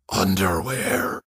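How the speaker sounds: slow, drawn-out breathy word; dazed patient breathing one word; breathed. A narrator's reading. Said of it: "he breathed".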